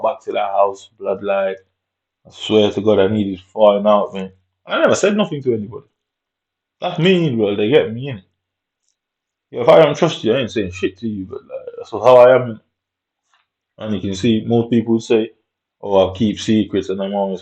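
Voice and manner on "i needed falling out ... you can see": ordinary talk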